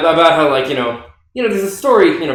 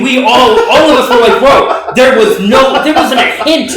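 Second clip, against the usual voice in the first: loud, high laughing protest; laughing outcry; 8 semitones higher, 7 dB louder than usual. Real, not acted. Friend to the podcast guest, laughing and loudly protesting. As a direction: shout it out through laughter.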